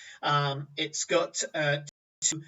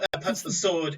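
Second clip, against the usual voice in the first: normal talking voice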